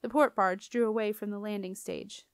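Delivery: plain reading voice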